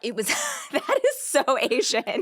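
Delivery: real delighted surprise breaking into a laugh; squealing recognition with a laugh; excited gasp. Real, not acted. Gasps at hearing Edison, New Jersey.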